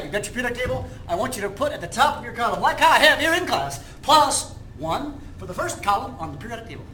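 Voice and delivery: upbeat salesman pitch; car salesman. Acted. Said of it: "Do I sound like a car salesman?".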